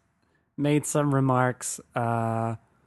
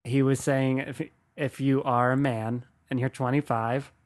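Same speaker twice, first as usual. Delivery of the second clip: normal talking voice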